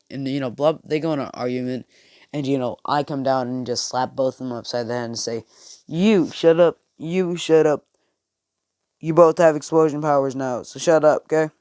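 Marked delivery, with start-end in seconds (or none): 5.9-11.5 louder, higher, exasperated telling-off; scolding shout. Acted. Speaker scolding Deku and Bakugo after slapping them, loud. Narrator scolding both boys.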